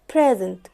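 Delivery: "present" as PREZ-ent, falling away on -ent; stress on first syllable. Shown as a demonstration. Teacher repeating the noun to close the contrast, "present" as PREZ-ent. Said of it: "present كنون السترونج سيلابل كان المقطع".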